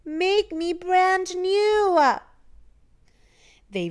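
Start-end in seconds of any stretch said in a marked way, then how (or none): 0.1-2.0 high, slow, louder plea; slow little puddle voice. A narrator's reading. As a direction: call up from the puddle, drawn out.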